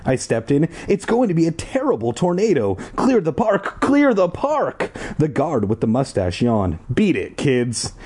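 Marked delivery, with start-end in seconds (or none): 0.9-4.9 rising urgent plea. Acted. Urging the guards to act. 6.9-7.9 slow, bored brush-off. Acted. Guard dismissing the kids, slow and bored. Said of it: "he said in a bored voice".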